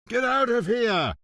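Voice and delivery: high, loud order; the inspector barks at the employees; yelled. Acted. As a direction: drive the staff out of the room. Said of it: "Montalbano yelled at the two".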